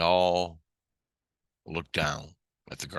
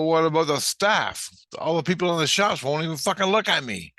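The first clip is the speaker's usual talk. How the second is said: high, loud, exasperated demand; agitated protest; 9 semitones higher, 5 dB louder than usual. Retold or imitated. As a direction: demand an answer, voice raised. Agitated protest in retold argument.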